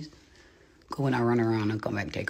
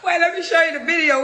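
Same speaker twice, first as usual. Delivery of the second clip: much higher, louder urgent squeal; shrieking excitement over a video; high excited squeal; 9 dB louder than usual. Real, not acted. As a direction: squeal it in disbelief.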